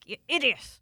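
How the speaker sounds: mock scolding herself; self-scolding. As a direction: scold yourself, exasperated. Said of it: "I was like".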